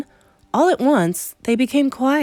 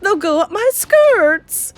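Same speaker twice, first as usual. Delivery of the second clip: shrill, loud, hysterical screeching; Mrs Jenkins; 12 semitones higher, 6 dB louder than usual. Acted. Wife begging husband to rescue her, hysterical. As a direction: shriek high in comic panic. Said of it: "it was screaming".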